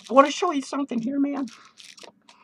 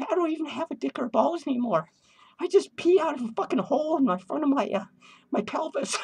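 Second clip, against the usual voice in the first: ordinary talk, nothing marked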